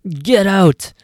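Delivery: furious clerk chasing out the customer; screaming. Acted. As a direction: throw her out at full voice. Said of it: "the clerk screams".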